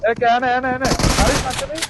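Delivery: high urgent callout; excited shout. Real, not acted. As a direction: yell it out in the heat of the fight. Excited callout during firefight.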